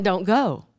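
softly firm restraint; God's quiet warning. Acted. God answering the headstrong sheep, quietly. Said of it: "But God is saying".

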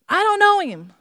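acted furious denial; shouted denial. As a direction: shout it down once and for all. Peter's final angry denial.

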